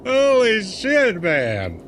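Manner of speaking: high and drawn out slowly; stunned awe. Real, not acted. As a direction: stretch it out, genuinely amazed. Laughing hard at the clip.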